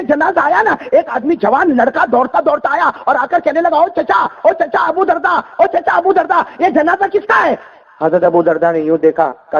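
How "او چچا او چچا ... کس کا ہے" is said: high, slow calling shout. Acted. Young man running up, shouting to his uncle. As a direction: call out to the old man as you run up.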